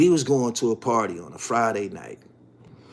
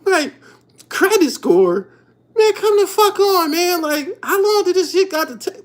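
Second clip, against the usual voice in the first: laughing disbelief; 6 dB louder than usual. Real, not acted. Mocking credit score wait.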